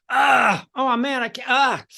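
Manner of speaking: exasperated groans breaking into the words; frustrated sounds. Shown as a demonstration. Storytelling coach shows a frustrated outburst. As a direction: let the frustration spill out as noise. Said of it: "Or do you go".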